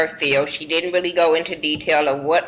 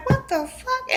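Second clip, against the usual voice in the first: much higher, softer what the fuck; shocked squeak; 7 dB quieter than usual. Retold or imitated. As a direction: squeak it out in disbelief. Kandi shocked at being addressed.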